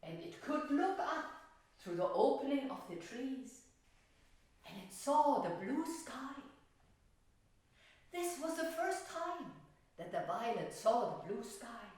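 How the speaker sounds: put-on gentle storytelling to children; story narration. A narrator's reading. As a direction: read it like a fairy tale for little ones. Teacher tells the violet story.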